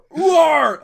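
a loud, high mock roar. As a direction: roar at the passenger for a laugh. Cabbie roars at the passenger.

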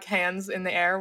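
usual speaking voice

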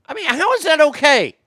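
high, loud outraged question. Real, not acted. Host reacting to politicians, loud outraged disbelief.

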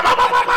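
high, loud pop pop gunshot noises; mock gunfire. Joking about school danger, mock gunshot pops. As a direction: spit out gunshots like a cartoon.

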